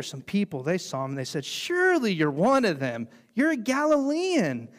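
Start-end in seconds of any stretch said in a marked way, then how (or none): 1.4-4.7 high, louder, slowing accusation; accusing bystanders. Acted. Bystanders accusing Peter, high and insistent. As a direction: press the charge, rising higher.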